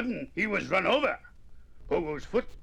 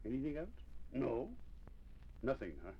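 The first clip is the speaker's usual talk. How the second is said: ordinary delivery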